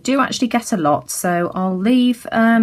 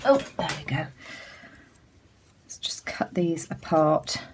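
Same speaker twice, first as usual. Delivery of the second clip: whispering; 6 dB quieter than usual. Real, not acted. Muttering to self while cutting.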